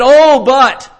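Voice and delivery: high, loud protest; loud, high-pitched 'but' of self-justification; protesting excuse. Acted. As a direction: blurt out an objection when caught out. Saul caught out, protesting to Samuel.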